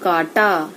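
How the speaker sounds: काटा with a plain aa, low and falling; काटा, oral a, low pitch; careful kaata. Shown as a demonstration. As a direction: say काटा with no nasal, dropping low. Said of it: "इसका बार बार उच्चारण करना है".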